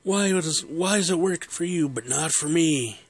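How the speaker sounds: a father losing it over a car horn; flustered dad. Retold or imitated. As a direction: whine it out, all flustered. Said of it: "he's all flustered".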